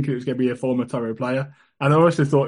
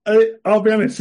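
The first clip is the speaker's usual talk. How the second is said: laughing; 7 semitones higher, 3 dB louder than usual. Real, not acted. Player laughs after getting it.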